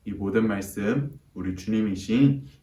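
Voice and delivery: soft, even-paced prayer voice; spoken prayer. Real, not acted. Preacher prays to God on behalf of the children, hushed prayer. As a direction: pray earnestly and quietly to God.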